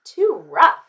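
loud, put-out complaint; emphatic, hurt verdict; pained cry. A narrator's reading. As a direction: protest after a painful jolt. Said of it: "she cried".

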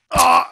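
short loud high "ah"; startled cry. Real, not acted. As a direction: yelp as if suddenly hit. Cries out as he is hit.

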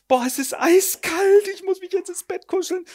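shiver through it; a freezing woman whimpering; mock shivering. Shivering character.

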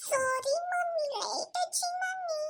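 put-on high, slow, soft little girl apologising; young daughter apologising to her mum in a high child voice. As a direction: play a small child saying sorry, drawn out.

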